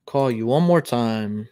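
usual speaking voice

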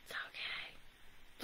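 whispered under the breath. Real, not acted. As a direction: breathe it out to yourself. Consoling himself after lost bet.

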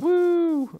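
high happy woo; excited whoop. Real, not acted. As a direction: whoop with glee. Cheering while running race.

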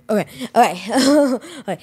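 ordinary talk, nothing marked

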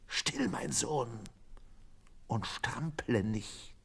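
a hushed, soothing sailor, sinking to a whisper; calm the struggling monkey, quietly and firmly; sailor hushing. A narrator's reading. The sailor Schmidt calming the captured monkey, hushed whisper. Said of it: "ihn der Mann und spricht".

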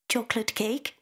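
put-on high little girl voice, a tentative guess; hesitant child guess. A narrator's reading. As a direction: guess it like an eager small child. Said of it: "she hazards".

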